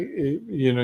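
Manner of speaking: usual speaking voice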